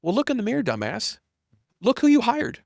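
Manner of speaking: high, loud mock reprimand; blunt self-scolding. Reality answering a self-pitying founder, blunt scolding. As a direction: act out a hard reality check.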